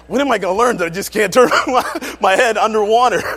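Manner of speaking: mock despair, breaking into laughter; laughing lament. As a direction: wail it while laughing. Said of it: "and it's like".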